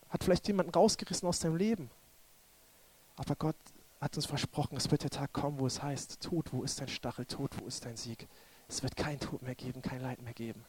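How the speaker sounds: very soft, low consoling voice; quiet, low and solemn; hushed pastoral prayer. Real, not acted. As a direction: console the grieving, almost under your breath.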